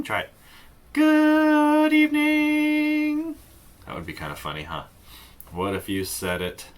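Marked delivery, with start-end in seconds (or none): 0.9-3.4 high, slow robotic monotone; loud, held on one high note like a robot. Shown as a demonstration. Teacher shows kids a robot good evening, monotone. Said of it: "like a robot".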